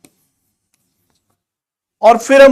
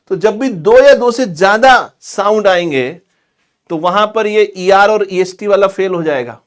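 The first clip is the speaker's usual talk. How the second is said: normal talking voice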